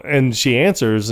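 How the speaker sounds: ordinary talk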